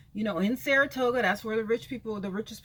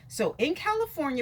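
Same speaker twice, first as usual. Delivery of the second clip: ordinary talk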